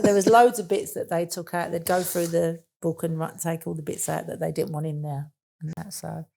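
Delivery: ordinary talk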